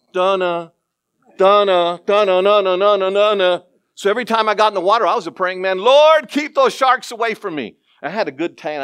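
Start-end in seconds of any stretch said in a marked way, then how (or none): 0.1-3.6 hummed shark-theme dunna dunna; Jaws theme hum. Retold or imitated. Recalling Jaws before surfing, humming the theme. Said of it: "I remember seeing Jaws". 5.8-7.8 high, loud pleading cry to the Lord; mock frantic prayer. Surfer in the water begging God, mock panicked. Surfer praying against sharks.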